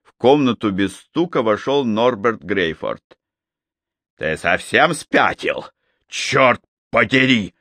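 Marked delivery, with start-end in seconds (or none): 4.2-7.5 roaring. Acted. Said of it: "проревел Норберт".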